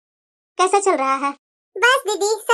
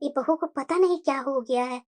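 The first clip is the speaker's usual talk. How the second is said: quiet worried aside; 12 semitones lower, 11 dB quieter than usual. Acted. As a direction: worry under your breath about the bahu. (बहू की चिंता में धीमे, भारी स्वर में बुदबुदाना)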